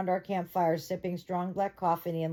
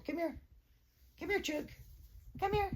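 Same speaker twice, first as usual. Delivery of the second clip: high, soft sing-song coaxing; 11 semitones higher, 9 dB quieter, faster than usual. Real, not acted. Coaxing her dog onto the bed in a high soft coo.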